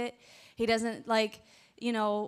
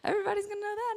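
much higher, small-voiced acceptance; thrown-off reaction; 11 semitones higher, much faster than usual. Wife reacting to her husband's public announcement, high and thrown off. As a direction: squeak out a mock uneasy okay, high-pitched.

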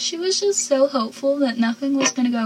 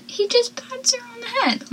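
mock outrage; 13 semitones higher, 3 dB quieter than usual. Mocking Dawson's brush-off.

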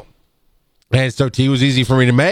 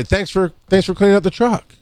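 higher, friendly appreciation; upbeat coworker thanks; grateful thanks; 7 semitones higher than usual. Shown as a demonstration. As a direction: thank him lightly and sincerely. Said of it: "I'm still gonna say".

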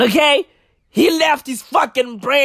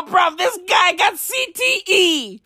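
ordinary talk

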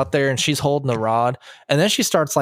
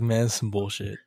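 muttered grumble; 3 semitones lower, 7 dB quieter than usual. Retold or imitated. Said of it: "so jealous".

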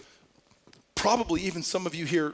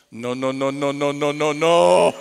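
building to a loud, low-voiced no, no; mock scolding no; 3 semitones lower, 6 dB louder than usual. Shaq as driver scolding the passenger, a loud mock no. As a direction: wag a finger at her with a booming no.

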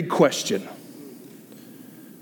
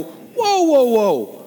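inner whoa; 8 semitones higher, 3 dB louder, much slower than usual. Retold or imitated. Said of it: "I go".

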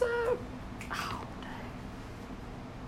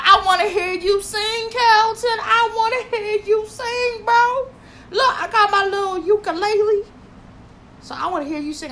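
much higher, pleading mock-John voice; imitating John; 10 semitones higher, 6 dB louder than usual. Imitating a friend begging Carlton to sing, higher. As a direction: whine it like a pushy buddy. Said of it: "he was all about".

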